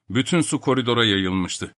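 ordinary delivery

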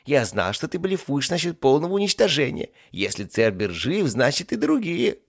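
high, loud gloating demon; gloat, slowing down to drive it home; triumphant accusation. Acted. Demon claims others survived too, triumphant. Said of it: "торжествующе заявил Кебаб".

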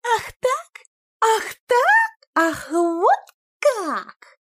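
put-on very high, tearful outraged girl; indignant shriek. A narrator's reading. Люба insulted by the cousins, high tearful outrage. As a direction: wail in hurt fury.

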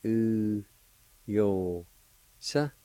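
U, yo, tsa, each drawn out and set apart; syllable by syllable. Shown as a demonstration. Teacher showing learners the word for flaw, syllable by syllable. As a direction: sound out "U, yo, tsa" with pauses between. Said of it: "It's pronounced like this".

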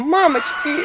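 high, mock-panicked yelp; alarmed cry. Heroine holding the door against villagers, alarmed cry. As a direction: wail it in comic alarm.

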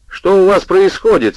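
loud, high, irritated question; angry demand. A narrator's reading. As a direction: snap it at the neighbours. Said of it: "сердито спросил Доссон".

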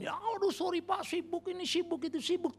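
high, slow whining excuse; busy excuse. Retold or imitated. Churchgoer makes excuses to the pastor, whining high. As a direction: beg off like someone too busy to serve. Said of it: "Karena kerja ini".

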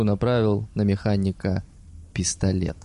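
ordinary delivery

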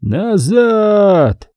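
high, long-held wailing cry; mournful trumpet call. A narrator's reading. Trumpet recalling the riders, mournful high wail. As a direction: draw it out like a sad bugle. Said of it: "печально заплакала труба".

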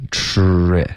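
"tre" with a curled-tongue, full tr; fuller retroflex. Shown as a demonstration. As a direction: round out the tr in "tre". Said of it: "稍微饱满一点". ("tre" 卷舌、发音饱满)

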